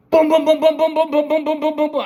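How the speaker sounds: loud, high rattling boom boom of an M60; machine gun noise. Retold or imitated. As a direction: bark out the burst like a gun firing. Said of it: "I just light him up with the M sixty".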